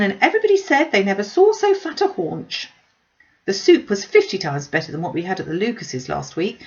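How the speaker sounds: a proud mother boasting about the dinner; gushing Mrs Bennet. Acted. Mrs Bennet voiced by narrator.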